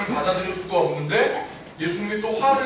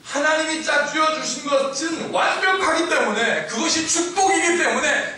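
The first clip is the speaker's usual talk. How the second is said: high, deliberate, impassioned proclamation; ringing high-pitched sermon climax; full-force preaching; 11 semitones higher, 5 dB louder than usual. Real, not acted. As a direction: drive each reason home with rising fervour.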